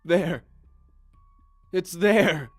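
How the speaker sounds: high, loud terrified shriek. Acted. Sobbing prisoner seeing the thing, shrieking. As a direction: scream in terror. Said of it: "The sobbing prisoner shrieked".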